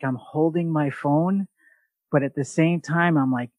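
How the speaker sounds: ordinary talk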